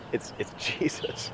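breathless laugh on the name; joy breaking into a laugh; laughing reveal. Real, not acted. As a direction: giggle the reveal out softly. Joyful reveal of Jesus.

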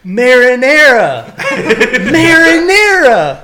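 loud, high, drawn-out cry; mock outraged shout. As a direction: belt out mom's text in hammed-up alarm. Acts out mom's exclamatory texts.

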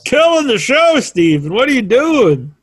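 high, exasperated scolding; playful yelling. Real, not acted. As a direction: tell off a bungling guest, mock-angry. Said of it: "I don't wanna yell at you".

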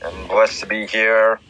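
low, solemn preacher voice; preaching. Acted. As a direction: lay it down like a sermon.

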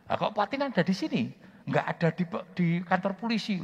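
high, loud, quick disbelief; staring at him in shock; astonished colleague. Retold or imitated. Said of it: "teman-teman ngomong begini". (teman terheran-heran)

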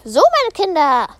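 odd coach voice. Acted. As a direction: greet the kids in a weird voice. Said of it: "Wieso hast du so eine Stimme".

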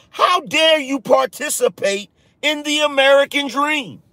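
imitating Wall Street types scolding regular people; mocking elitist outrage. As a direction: sneer it like an offended elite. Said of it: "That's all I heard".

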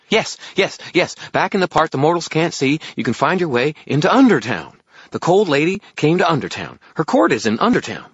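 high, loud, urgent fairy; impatient fairy. Acted. Fairy hurries through directions for wizard, impatient high voice. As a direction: pipe the answer out impatiently.

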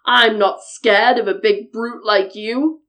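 loud, bold billy goat challenge; big goat squaring up to the troll; defiant biggest goat. A narrator's reading. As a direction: declare it fearlessly in a big hoarse voice. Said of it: "declared the biggest billy goat".

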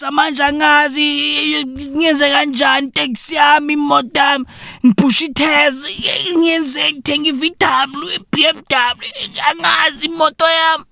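Zulu-speaking driver whining about petrol, ending in a shout; mock complaining driver. Mocking complaining motorists, rising to a shout.

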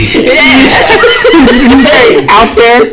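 slow, laughing through the words. Real, not acted. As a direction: let the laugh carry the words. Laughing while talking.

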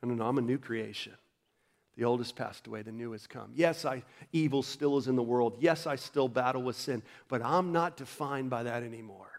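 soft, private reminder to yourself; preaching truth to yourself quietly; quiet self-talk. Shown as a demonstration. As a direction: murmur it to yourself. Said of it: "preach to yourself".

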